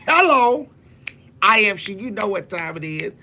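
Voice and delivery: high, ringing shout of self-announcement; loud showy exclamation. Acted. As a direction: belt it out high and loud, showing off. Boastful exclamation in story.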